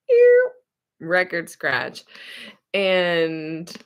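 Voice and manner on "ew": high, loud disgusted Ew. Retold or imitated. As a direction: squeal Ew. in disgust, louder and higher.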